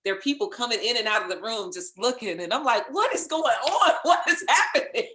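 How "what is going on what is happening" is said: high, loud, laughing disbelief; mock bewilderment. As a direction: replay your baffled reaction, pitched way up and laughing. Retells her bewilderment in the booth.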